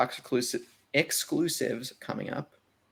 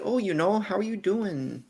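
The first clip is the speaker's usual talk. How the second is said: put-on higher, drawn-out Midwestern accent; 8 semitones higher, slower than usual. Trying out a Midwestern accent for a co-host, higher-pitched and slow. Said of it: "Oh, so it's like".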